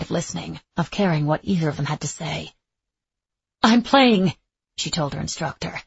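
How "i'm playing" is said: higher, louder, firm announcement; defiant declaration. A narrator's reading. Etta tells her instructor she will play, defiant and raised. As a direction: declare it as final.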